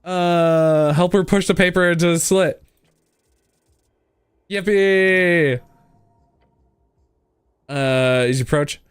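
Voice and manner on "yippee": excited yippee. Acted. Cheering success.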